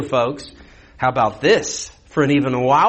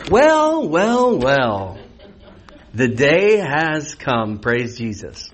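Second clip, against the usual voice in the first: mock gloating; 2 semitones lower, much slower than usual. Preacher playing the judge as the day finally comes, mock gloating. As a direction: savor the long-awaited moment, slowing right down.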